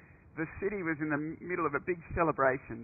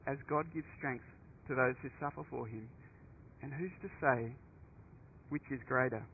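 softer repeat; 8 dB quieter than usual. Shown as a demonstration. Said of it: "quiet repeat, dropping".

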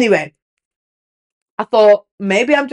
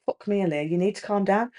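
hushed, lower aside to herself; quiet self-scolding thought; inner voice; 3 semitones lower, 10 dB quieter than usual. Retold or imitated. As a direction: say it under your breath like a private thought. Acting out her own thoughts.

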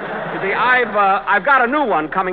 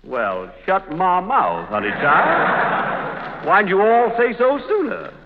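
slow, drawled put-on Southern accent, hammed up; slower than usual. Jack hams a Southern drawl to land the leading role. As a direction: lay on a thick Southern drawl to win the part. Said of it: "one of those charming Southern accents".

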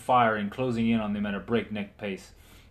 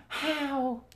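a stunned, much higher gasp of a question; stammered how. Acted. Margaret stunned at the knight's return, stammering. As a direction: barely get the word out in disbelief. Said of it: "she stammered".

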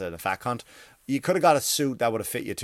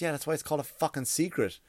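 usual speaking voice

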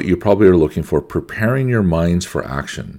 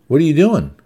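high-pitched, indignant wife imitation; mock a wife's startled objection, voice jumping up; protesting wife; 6 semitones higher than usual. Wife objects to husband dying on her, mock protest. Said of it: "She's going".

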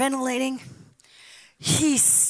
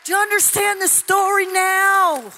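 raised, higher preaching voice; louder, pitched-up challenge; preaching shout; 7 semitones higher, 6 dB louder than usual. Real, not acted. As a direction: demand it of the crowd. Repeated challenge.

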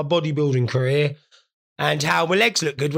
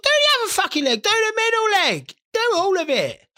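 much higher, excited pleading; eager high begging. Retold or imitated. To the tanning lady, high excited begging. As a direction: beg her eagerly in a high voice. Begging tanner to spray more.

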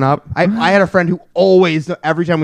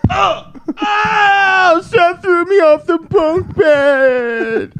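very high, long drawn-out wail; hammed-up child crying out in pain; faking injured kid; much slower than usual. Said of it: "I better just hurl myself over the".

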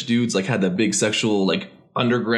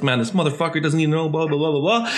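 raised, fast mock rant; ranting Mike; 4 semitones higher, faster than usual. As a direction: rattle it off like an annoyed lifter. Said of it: "Mike went off on the crowd".

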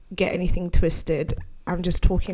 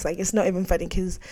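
ordinary delivery

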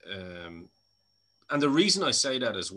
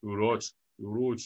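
imitated Irish accent. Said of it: "We have some of that over here".

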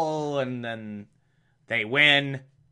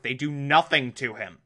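normal talking voice

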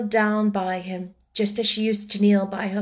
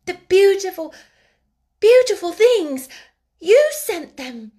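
much higher and louder, overflowing joy; joyful outburst; 10 semitones higher, 8 dB louder than usual. Acted. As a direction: cry out in grateful delight, slowing at the end. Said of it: "joyful, emotional little voice".

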